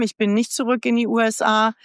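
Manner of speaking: usual speaking voice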